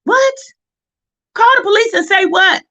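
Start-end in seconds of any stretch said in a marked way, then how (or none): none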